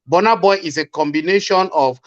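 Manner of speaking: normal talking voice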